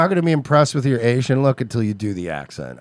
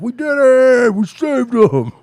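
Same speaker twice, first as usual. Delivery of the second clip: mock triumphant voice; 12 semitones higher, 7 dB louder than usual. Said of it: "they're like".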